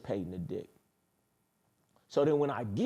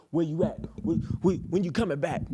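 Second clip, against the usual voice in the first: higher, louder, imitating a nagging partner; mock demanding questioning; 7 semitones higher, 4 dB louder than usual. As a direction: fire the questions as a mock interrogation. Mocks a woman questioning the king.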